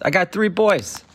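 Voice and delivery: ordinary talk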